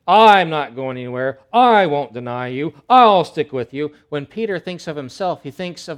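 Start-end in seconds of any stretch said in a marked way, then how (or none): 0.1-3.9 loud, slow, puffed-up promise; proud loud vow. Acted. Peter loudly and proudly promising to stick with Jesus. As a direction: declare it proudly. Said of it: "He says".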